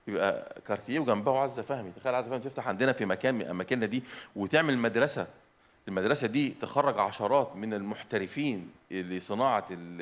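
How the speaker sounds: ordinary delivery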